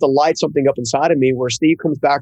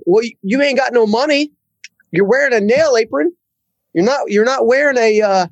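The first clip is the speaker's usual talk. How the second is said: put-on Southern accent; 9 semitones higher than usual. Boss doubting the young worker, in a high Southern accent. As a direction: sound like the North Carolina boss with a Southern accent. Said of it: "A good old Southern guy from North Carolina".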